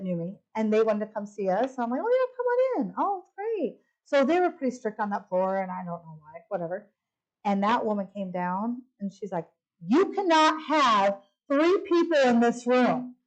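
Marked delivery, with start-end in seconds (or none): 2.0-3.8 high, gushing welcome to visitors; delighted welcome. Retold or imitated. Woman welcoming visitors to the hospital room, delighted. 9.8-13.2 slow, higher-pitched scolding imitation; mimicked stern desk lady. Desk lady telling visitors the room is too full, mock-stern.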